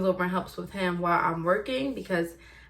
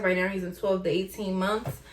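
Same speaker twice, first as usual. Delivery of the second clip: normal talking voice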